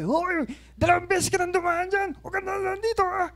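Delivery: high, mock yell at him. Gasoline boy yelling at the pastor for passing twice, high mock yell. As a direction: imitate the gas boy yelling. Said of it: "sinisigawan ako eh".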